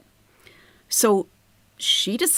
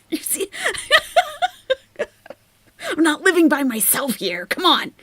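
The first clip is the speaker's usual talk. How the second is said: laughing; 9 semitones higher than usual. Real, not acted. Laughing aside to audience.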